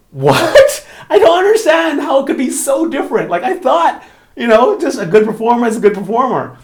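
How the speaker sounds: high, loud, half-laughing disbelief; incredulous. Retold or imitated. Performer retelling shock at a colleague's praise, incredulous. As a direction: squeal the disbelief, retelling your own baffled reaction.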